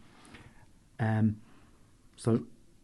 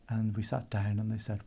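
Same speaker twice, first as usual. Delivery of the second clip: ordinary delivery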